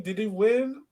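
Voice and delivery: usual speaking voice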